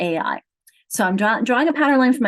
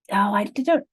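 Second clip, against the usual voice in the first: normal talking voice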